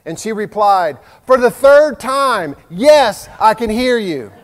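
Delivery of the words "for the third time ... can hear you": higher and louder, fed up; exasperated wife. Retold or imitated. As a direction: snap back at the husband. Wife snaps back, annoyed.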